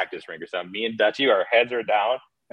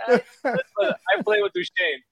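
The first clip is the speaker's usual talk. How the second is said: much higher, hammed-up mock Russian accent; Russian accent imitation. Panarin repeating himself to the coach, hammed-up Russian accent. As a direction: squeak it out like the nervous Russian again. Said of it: "He's like".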